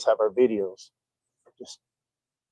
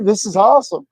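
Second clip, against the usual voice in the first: excited; 10 semitones higher, 6 dB louder than usual. Retold or imitated. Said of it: "I was like".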